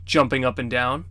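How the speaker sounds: plain reading voice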